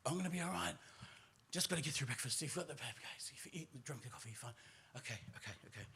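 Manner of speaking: very soft, rapid self-reassurance; muttered pep talk. Retold or imitated. Storyteller alone in the cubicle, muttering reassurance to himself. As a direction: mutter it to yourself to calm down.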